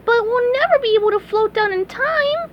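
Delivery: high cartoon sponge panic; SpongeBob cry. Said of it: "cried SpongeBob".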